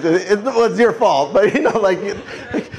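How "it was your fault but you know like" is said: ordinary delivery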